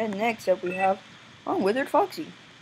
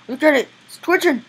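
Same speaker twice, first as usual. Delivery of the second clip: higher, louder with each phrase; acted alarm; 8 semitones higher, 8 dB louder than usual. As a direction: exclaim in mock horror, climbing. Voices character with twitching hand.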